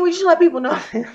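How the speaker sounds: fast, high words broken by a laugh; real laugh mid-rant; laughing while talking. Real, not acted. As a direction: laugh through the line. Laughs mid-line while ranting.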